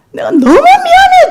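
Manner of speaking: high, loud tearful cry; crying prayer. Retold or imitated. Mother weeping before the Lord, loud high cry. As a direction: wail the apology through tears. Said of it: "하고 제가 막 울었습니다".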